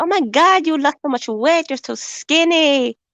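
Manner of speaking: put-on high, exclaiming Filipino auntie accent. Auntie seeing her niece thinner, Filipino accent. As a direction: mimic a shocked Filipino auntie, last words drawn out. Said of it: "I cannot win with Filipino aunties".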